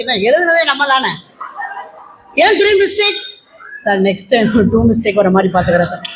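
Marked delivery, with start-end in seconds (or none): 2.4-3.2 higher-pitched mock interrogation; demanding teacher. Teacher questioning pupil over mistakes, high-pitched demand. 3.8-6.1 low-pitched, submissive mock reply; meek pupil. Pupil promising strict teacher improvement, low and meek.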